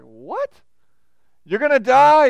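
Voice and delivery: incredulous "what?" jumping up into a loud exclamation; startled reader. Acted. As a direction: blurt it out in mock shock, loud and high. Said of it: "you're like".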